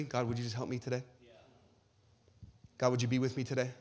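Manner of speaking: mock a half-hearted routine prayer; generic prayer. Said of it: "when we pray generically".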